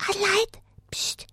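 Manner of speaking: puppet whispering a secret; hushed conspiratorial whisper. Acted.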